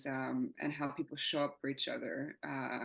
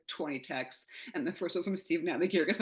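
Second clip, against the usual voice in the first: normal talking voice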